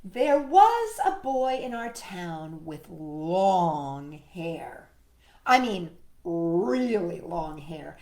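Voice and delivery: slow, low storytelling voice; unhurried read-aloud poem narration; reading to a child. A narrator's reading. Reading a poem aloud to Sparky, slow storyteller.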